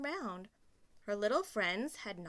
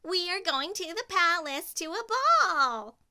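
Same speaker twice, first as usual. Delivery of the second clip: delighted stepsisters; 9 semitones higher, 9 dB louder than usual. Acted. Stepsisters to each other, high and gushing. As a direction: gush with glee. Stepsisters gush about ball.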